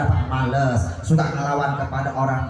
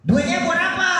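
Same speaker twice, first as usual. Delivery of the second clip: high rhetorical cry. Acted. Preacher's sudden high rhetorical cry.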